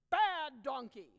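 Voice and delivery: loud scolding of a donkey; angry rider scolds his donkey. Acted. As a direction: bark it at the donkey like an angry master.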